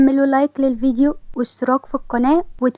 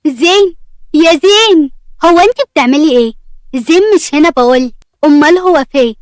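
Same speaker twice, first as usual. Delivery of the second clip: calling loudly; 3 semitones higher, 4 dB louder than usual. Acted. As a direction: call across the house for Zain. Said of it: "أسيل بعلو صوتها".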